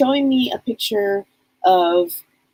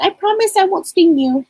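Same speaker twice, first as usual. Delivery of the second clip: playful promise; 10 semitones higher, 5 dB louder than usual. Retold or imitated. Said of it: "she's so damn funny".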